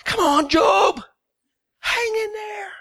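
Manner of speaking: high, cheering angel chant; cheerleader encouragement. Pretend. Angels cheering on Job, a playful high chant. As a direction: cheer him on like a pom-pom angel. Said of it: "with their pom-poms".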